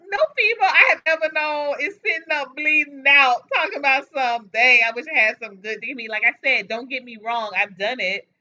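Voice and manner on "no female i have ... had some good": a loud, high, indignant rant. Real, not acted. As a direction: rant it out at full volume. Ranting loudly.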